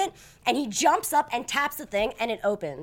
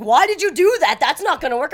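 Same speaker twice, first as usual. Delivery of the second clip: higher, louder scolding; 5 semitones higher, 8 dB louder than usual. Retold or imitated. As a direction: tell her off like an annoyed attendant. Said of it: "now he's scolding me".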